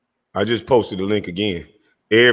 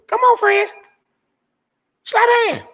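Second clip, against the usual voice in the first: very high, louder squeaky mock; mocking squeal; 4 dB louder than usual. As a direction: squeak it out like a taunt. Mocking invitation to rival.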